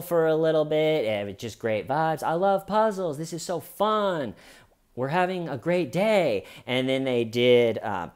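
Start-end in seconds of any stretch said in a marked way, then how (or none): 2.2-6.4 mock cheerful.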